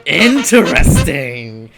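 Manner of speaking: slow, loud, drawn-out intrigue; drawn-out intrigued. Pretend. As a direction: stretch it out like a villain. Reacting to the matchup reveal.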